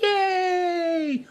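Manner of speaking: high squealing yay; kids cheering. Retold or imitated. Kids in math class cheering a word problem, squealing. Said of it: "Kids are like".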